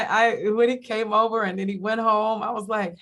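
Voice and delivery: normal talking voice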